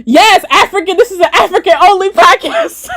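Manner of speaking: high, drawn-out mock proclamation; Doctor Umar. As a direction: proclaim it in a hammed-up impression. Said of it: "Doctor Umar voice".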